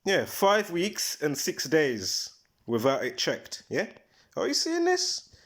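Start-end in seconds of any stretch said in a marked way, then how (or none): 4.4-5.3 much higher, "this" lifted in amazement; excited disbelief. Real, not acted. Vlogger urging viewers to look at the match, excited disbelief. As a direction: pitch it way up, can you believe it.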